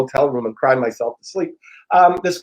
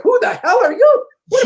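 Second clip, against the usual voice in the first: very high, louder mock outrage; incredulous challenge; 5 dB louder than usual. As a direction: imitate affronted strangers, half laughing. Strangers' first reaction to his intrusion.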